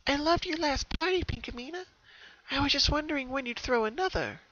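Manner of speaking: high, sweet, bright-eyed filly voice; high pony voice. Acted. A sister's voice praising Pinkamena's party, high and sweet. Another pony's voice read aloud.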